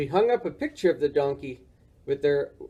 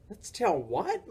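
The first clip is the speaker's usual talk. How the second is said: puzzled question; 4 semitones higher, 6 dB quieter, slower than usual. Acted. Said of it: "Morris asked".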